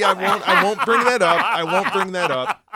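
chuckling through the words; giggling teaser; laughing. Real, not acted. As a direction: laugh while holding back the story. Teasing a withheld joke.

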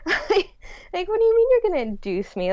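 high, fast and incredulous. Retold or imitated. To the doctors, pregnant woman questioning the induction in disbelief. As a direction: pitch it up in outraged disbelief. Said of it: "And I'm like".

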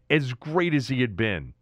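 usual speaking voice

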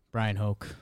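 ordinary talk, nothing marked

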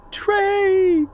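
very high cry for help; terrified scream. A narrator's reading. Celeste dragged back from the cabin, screaming for Trey. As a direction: shriek the name high, restrained in volume. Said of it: "she screamed".

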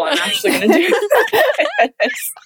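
high laugh bursting mid sentence; can't hold back a laugh; excited laugh. Real, not acted. Thrilled trying on pads, laughing high. Excited about football gear.

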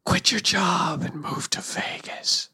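eerie whispered command; ghostly whisper. Retold or imitated. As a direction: phantom whisper.

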